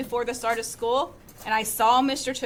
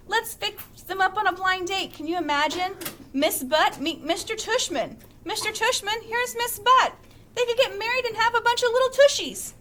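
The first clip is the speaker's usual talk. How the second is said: higher, eager, quickening; excited; 7 semitones higher than usual. A narrator's reading. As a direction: pitch the joke with delight. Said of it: "said Dad said excitedly".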